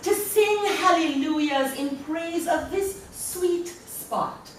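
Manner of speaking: laughing through a playful poetry reading; poem reading. Acted. Poet reading her ritual poem, amused and playful. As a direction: read the spell like a wry recipe. Reads second poem aloud.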